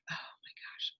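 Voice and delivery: breathless awed whisper; whispered oh my gosh. Retold or imitated. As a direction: whisper it, stunned and delighted. Retold reaction to vet's advice.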